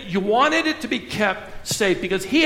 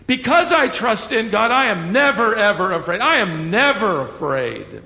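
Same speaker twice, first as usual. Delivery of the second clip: "never" punched louder, mock-pious; stress on never; 3 dB louder than usual. Preacher mocking the expected testimony, stressing "never". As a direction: hit "never" hard in a mock boast. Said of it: "Shouldn't he be saying".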